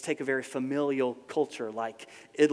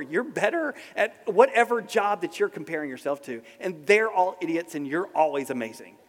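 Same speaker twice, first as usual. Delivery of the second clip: normal talking voice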